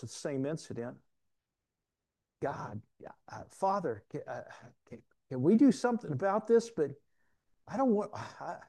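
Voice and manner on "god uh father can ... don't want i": halting, stammered prayer rising in pitch; acted anguished hesitation; reluctant plea. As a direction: plead haltingly, breaking off mid-thought. Acting out Jesus praying at Gethsemane.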